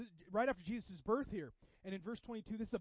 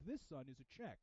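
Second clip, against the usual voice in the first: lower, softer, patient father; fatherly explanation; 5 semitones lower, 8 dB quieter than usual. Retold or imitated. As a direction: calmly teach your son. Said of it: "He's like".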